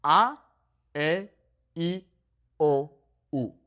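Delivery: short, crisp A, E, I, O, U one by one; Spanish vowels. Shown as a demonstration. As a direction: clip each vowel short and pure. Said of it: "how important it is to master the vowel sounds".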